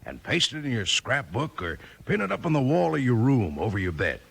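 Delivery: usual speaking voice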